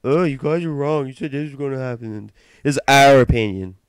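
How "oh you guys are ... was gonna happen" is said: higher-pitched mocking of protesting listeners; mock complainer. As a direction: imitate a listener jumping in to object. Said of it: "Just before anybody jumps ahead".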